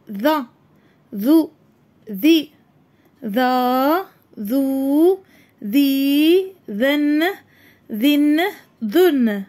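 ذال sounded with each vowel, teeth on tongue, clear and firm; letter drills. Shown as a demonstration. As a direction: model ذو ذي ذا for the class. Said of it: "حرف الذال".

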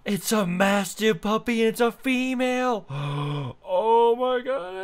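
high, loud gasping squeal over a puppy; excited squeal. Real, not acted. Finds a Mastiff puppy.

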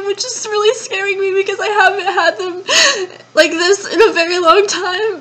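crying through a panic attack; real panicked sobbing. Real, not acted.

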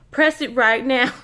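loud, slow mock pitchman command; college commercial guy. Urging viewers to hit subscribe, mock commercial pitchman. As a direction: order it like a hammed-up TV ad. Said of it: "trying to sound like that guy".

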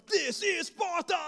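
slow, high mock battle cry; mock epic war cry; movie hero shout. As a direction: ham up the Spartan king. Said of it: "glory and battle".

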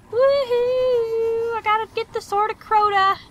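high, loud joyride cheer; excited whoop. Pretend. Whooping while riding.

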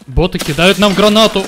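loud urgent warning shout; grenade warning yell. Real, not acted. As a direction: yell it to warn the team. Тиммейтам о летящей гранате. (громкий крик-предупреждение о гранате)

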